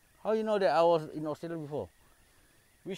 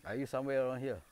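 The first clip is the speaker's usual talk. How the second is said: ordinary delivery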